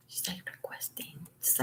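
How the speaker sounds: barely audible whisper. Real, not acted. Telling viewers about Amber's request, whispered. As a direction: hush it under your breath. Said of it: "whispered aside".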